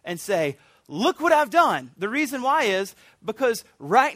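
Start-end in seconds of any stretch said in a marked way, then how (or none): 0.9-1.9 higher, louder and slow, proud; enthusiastic. Retold or imitated. Believer going to the Father, proudly enthusiastic. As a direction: present it proudly. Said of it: "enthusiastically go to your father".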